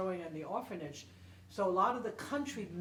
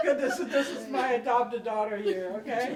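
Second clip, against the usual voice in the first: laughing; 4 semitones higher, 8 dB louder than usual. Real, not acted. Explaining while laughing.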